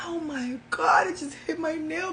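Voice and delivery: startled oh my God. Real, not acted. Crystal just hit her nail, startled exclamation. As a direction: caught off guard, voice jumps up.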